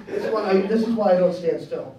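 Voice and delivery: ordinary talk, nothing marked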